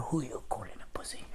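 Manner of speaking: very soft, whispered retort; hushed, affronted acted whisper; muttered retort. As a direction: whisper an indignant comeback under the breath. Muttered retort after being insulted.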